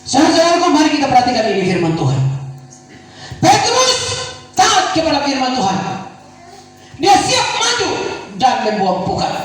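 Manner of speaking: rally the couples to church; preaching at full force. Acted. Pastor urging husbands and wives, shouted exhortation. Preacher proclaims Peter story.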